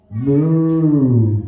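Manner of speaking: a loud, drawn-out put-on moo; mock cow moo. Preacher voicing the cow in his chicken parable, loud mock moo.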